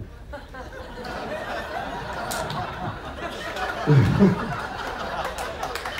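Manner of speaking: silent shaking laugh, air only; breathless wheezing laugh. Real, not acted. Cracking up over forty views.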